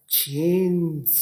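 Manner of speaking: जीन्स stretched slowly, falling at the end; drawn-out model word. Shown as a demonstration. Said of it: "अगला लफ्ज़ है".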